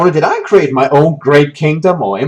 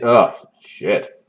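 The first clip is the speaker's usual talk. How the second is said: ordinary talk, nothing marked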